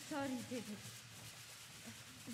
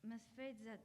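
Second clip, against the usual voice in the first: quiet sad news; 2 semitones lower, 19 dB quieter than usual. Acted. Doctor breaks news of death.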